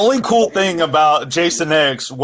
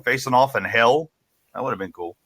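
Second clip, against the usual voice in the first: ordinary talk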